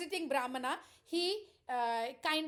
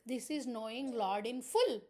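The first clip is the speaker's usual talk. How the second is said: ordinary delivery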